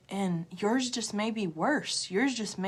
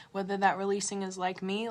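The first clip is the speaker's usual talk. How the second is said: usual speaking voice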